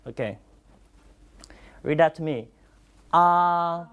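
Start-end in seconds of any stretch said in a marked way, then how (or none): 3.1-3.9 Ah held long and level; even, sustained Ah without any bend; first tone on Ah. Shown as a demonstration. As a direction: keep Ah on one steady pitch. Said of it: "First tone".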